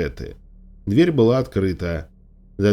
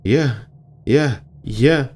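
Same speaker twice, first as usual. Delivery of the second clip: halting, higher-pitched stammering; agitated broken repetition; flustered stammer; 4 semitones higher, much slower than usual. A narrator's reading. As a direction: stumble over the word, too rattled to go on. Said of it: "забормотала я".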